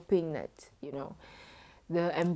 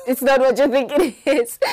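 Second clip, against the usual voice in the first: choked up crying; 7 semitones higher, 11 dB louder than usual. Real, not acted. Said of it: "I don't wanna cry on camera".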